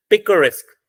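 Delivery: "पिकरेस्क" said firmly, falling on "रेस्क"; stress on last syllable. Shown as a demonstration. Teacher sums up three syllables, final stress on "पिकरेस्क". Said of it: "last पे".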